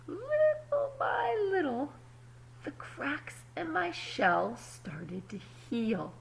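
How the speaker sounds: put-on calm storybook reading to kids; story narration. A narrator's reading. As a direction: read it like a picture book at story time. Reads the story aloud.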